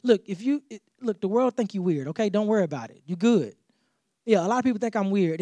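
ordinary talk

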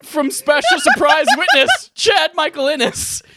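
talking through giggles; can't stop laughing mid-sentence; laughing while talking. Real, not acted. Laughing through the closing statement.

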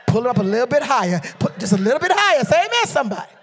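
loud, high, rousing preacher shout; full-force preaching. Real, not acted. Preacher jokes to congregation about free coaching, shouting exuberantly.

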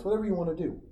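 normal talking voice

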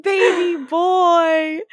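fond cooing. Real, not acted. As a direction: coo it with exaggerated affection. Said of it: "fond remark".

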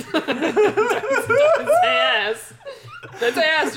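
laughing plea. Real, not acted. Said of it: "Don't say it".

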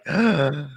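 imitated gasp. Imitating kids' reaction.

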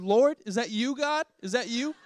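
mock awe.

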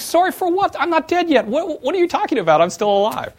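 high, loud disbelieving retort; stunned, half-laughing disbelief at condolences; incredulous outburst. Retold or imitated. CEO to friends offering condolences, incredulous outburst.

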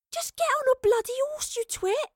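high-pitched, irritated order; exasperated scolding. A narrator's reading. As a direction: snap at him in a high voice. Astrid scolds the hapless soldier.

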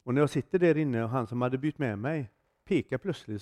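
ordinary delivery

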